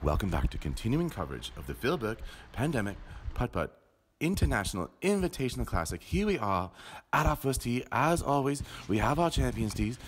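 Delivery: hushed, measured golf announcer voice; golf commentary. Pretend. Mock announcer calling a backyard golf round. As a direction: narrate a putt like a TV golf broadcast.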